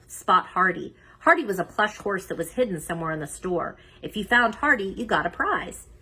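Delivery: ordinary delivery, nothing marked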